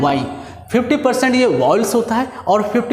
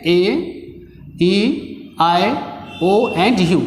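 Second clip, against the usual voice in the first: slow, letter by letter; spelling out vowels; much slower than usual. Shown as a demonstration. Teacher listing the five vowels to students, slow and deliberate. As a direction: lay out each vowel separately and slowly. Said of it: "इसको बोलते हैं".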